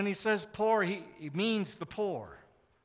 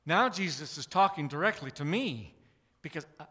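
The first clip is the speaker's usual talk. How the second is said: ordinary delivery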